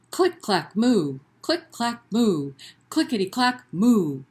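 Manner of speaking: rhythmic click clack with a big loud moo; read-aloud refrain; typing cow refrain. Acted. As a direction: bounce the clicks, belt the moo. Refrain again.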